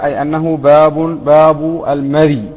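ordinary delivery

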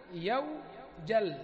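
يوجل drawn out very slowly, keeping the waw; slow demo of يوجل. Shown as a demonstration. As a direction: stretch يوجل slowly to show it stays regular. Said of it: "هذا على الأصل".

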